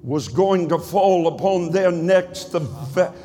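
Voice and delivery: rising, shouting preacher cadence; full-force sermon climax; preaching at full force. Acted. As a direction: preach it to the rafters, building.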